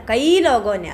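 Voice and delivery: teacher stressing the added word കൈ. Shown as a demonstration. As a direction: punch കൈ before ലോഗോനെ. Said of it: "ലോഗോനെ, എങ്ങനെയുള്ള ആളുകൾ?".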